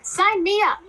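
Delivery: high-pitched eager demand. Acted. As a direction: jump at the chance, pitch leaping up. Eager entrant signing up for contest.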